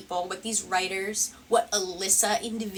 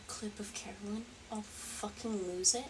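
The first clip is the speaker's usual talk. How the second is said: hushed, breathy aside under the clip; a secret aside to viewers; whispered aside; 14 dB quieter than usual. Real, not acted. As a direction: whisper it so only the chat hears. Muttered aside during the clip.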